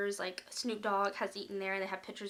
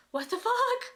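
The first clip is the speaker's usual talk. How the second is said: pitched way up in disbelief; high startled exclamation; shocked high what the fuck; 12 semitones higher, 4 dB louder than usual. Retold or imitated. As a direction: squeal it out, stunned. Freaked out exclamation.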